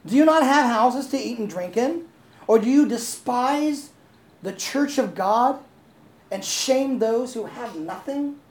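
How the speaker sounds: loud, high, indignant rebuke; emphatic apostle's reprimand, drawn out toward the end; irritated. Acted. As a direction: open with an exasperated burst, then slow into heavy scolding. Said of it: "So he's irritated".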